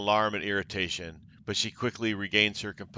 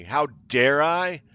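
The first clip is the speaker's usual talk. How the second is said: loud furious villain boast; hissing fury; 2 semitones higher, 6 dB louder than usual. Acted. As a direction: rage it out, louder each line. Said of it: "Xandria hisses in a fury".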